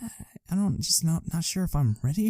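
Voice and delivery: halting, hushed reluctance; hesitant. Retold or imitated. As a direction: stall as you back out of it. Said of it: "like, hesitant".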